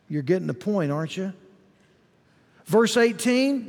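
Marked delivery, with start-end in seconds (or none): none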